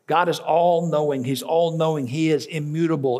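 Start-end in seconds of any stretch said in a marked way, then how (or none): none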